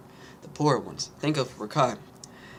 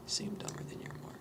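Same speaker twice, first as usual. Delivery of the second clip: whispering; 4 semitones higher, 12 dB quieter than usual. Real, not acted. Reading a quote to himself.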